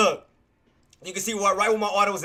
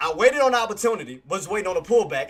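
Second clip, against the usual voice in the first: usual speaking voice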